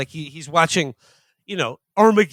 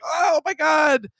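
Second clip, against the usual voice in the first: hammed-up shout of alarm as the agent; mock panic; 9 semitones higher than usual. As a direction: FBI agent hamming up panic at the chaos. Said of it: "and she's like".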